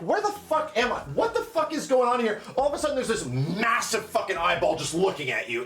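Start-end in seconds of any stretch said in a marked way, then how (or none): 0.0-2.4 confused alarm. Retold or imitated.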